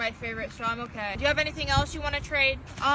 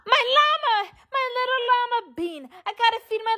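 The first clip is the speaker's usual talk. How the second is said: high excited cry; 9 semitones higher, 3 dB louder than usual. Acted. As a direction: squeal it out with delight. Excited about llama.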